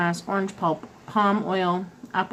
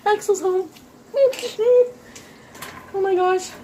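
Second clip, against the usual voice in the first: excited squeal; 11 semitones higher than usual. Real, not acted. Partner comes into kitchen.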